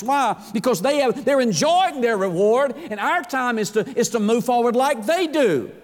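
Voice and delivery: rally the congregation to live fully; impassioned preaching. Acted. Preacher rises to exhortation.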